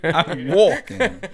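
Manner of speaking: laughing, badly faked New York accent; hammed-up New York street guy. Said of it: "Can't even do a proper accent".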